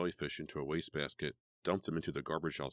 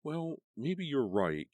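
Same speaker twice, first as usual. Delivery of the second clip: high, slow grudging agreement; reluctant; 10 semitones higher, 4 dB louder, much slower than usual. A narrator's reading. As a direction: give in slowly. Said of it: "Karen agreed".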